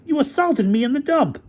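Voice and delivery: high-pitched indignant complaint; heated quarrel voice, way up high; outraged accusation. Acted. Quarrel, accusing the other officer.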